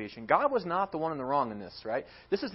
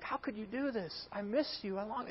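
high, soft, pained pleading; softly voiced heartache, pitched up; acted sadness; 6 semitones higher, 7 dB quieter than usual. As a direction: imitate a hurt spouse pleading quietly. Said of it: "And then he's sad".

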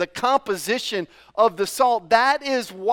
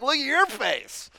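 mocking kid brother; 6 semitones higher than usual. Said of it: "He's like".

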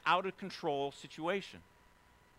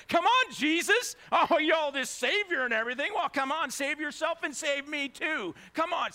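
loud, high, bitter taunt at Jesus; angry mocking thief; 13 semitones higher, 7 dB louder than usual. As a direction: sneer it out, jeering and furious. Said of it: "So he's angry, right? He's bitter".

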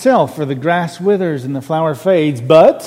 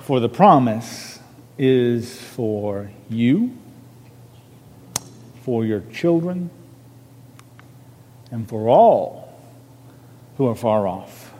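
scripture reading; 3 semitones lower, 4 dB quieter than usual. Acted. Reads the scripture verse.

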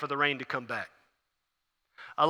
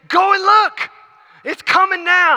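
very high, loud shouted command; fervent acted holler; urgent shout; 13 semitones higher, 8 dB louder, slower than usual. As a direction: yell it to the servant with total conviction. Elijah urgently orders the servant.

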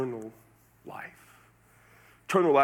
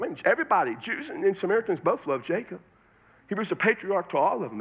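normal talking voice

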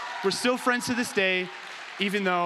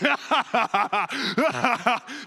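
high, loud maniacal laugh; gleeful laugh; 3 semitones higher, 5 dB louder than usual. Retold or imitated. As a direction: cackle with joy at the prank. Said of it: "I could hear his laughter".

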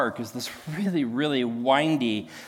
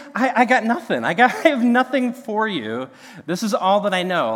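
laughing through it; 5 semitones higher, 5 dB louder than usual. Retold or imitated. Paraphrasing buddy, laughing.

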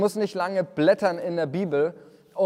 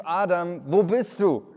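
loud, higher calling voice; God calling out; 4 semitones higher, 6 dB louder than usual. Acted. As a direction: call out across the garden, searching. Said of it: "Da heißt es nämlich".

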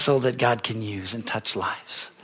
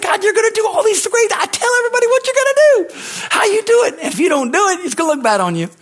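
much higher and louder, fast insistent plea; hammed-up frantic bargaining prayer; urgent shout to God; 5 dB louder than usual. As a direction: shout up at God, holding Him to His promise.

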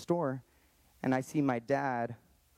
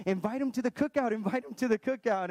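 high, eager, insistent, repeated; father-in-law urging him to invite the dad, high and excited; 9 semitones higher than usual. Retold or imitated. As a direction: imitate the father-in-law jumping at the idea, much higher. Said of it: "He was, like, shocked".

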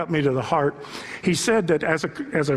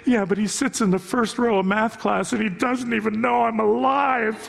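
mock lovesick schoolgirl; 5 semitones higher than usual. Girl's crush complaint retold.

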